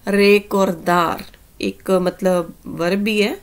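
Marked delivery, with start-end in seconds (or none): 0.1-1.2 recordar stretched out very slowly; very slow Spanish word for learners; slow teaching demo. Shown as a demonstration. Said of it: "next word ਹੈ". (recordar ਨੂੰ ਬਹੁਤ ਹੌਲੀ ਬੋਲੋ)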